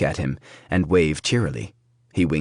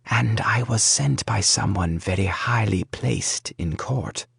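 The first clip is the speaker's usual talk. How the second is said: a stage whisper, breathy and carrying; conspiratorial loud whisper; 3 semitones higher than usual. A narrator's reading. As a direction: whisper it so the whole room hears. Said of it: "in a very loud whisper".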